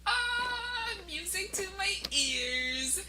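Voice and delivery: high, slow delighted "ah" then relief; delighted sigh. Retold or imitated. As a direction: savour it like good news. Reacting with delight to the nurse.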